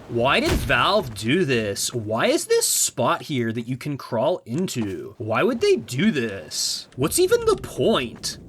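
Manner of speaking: slow, drawn-out questions with big swoops in pitch; mock baffled YouTuber. Mocking game critics on YouTube, slow swooping baffled whine. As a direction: whine like a clueless video essayist. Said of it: "when I talk like Half-Life two voice".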